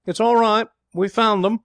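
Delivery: loud, high shout from far off. A narrator's reading. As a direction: call out the good news from the dark. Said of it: "Someone shouted".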